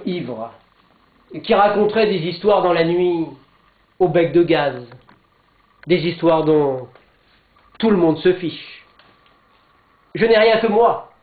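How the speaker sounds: declaimed verse with measured pauses between phrases; poem recitation. Acted. Speaker recites Jean-Pierre Remet's first canto to listeners, declaimed poem. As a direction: recite it line by line, rising in force on the pleas.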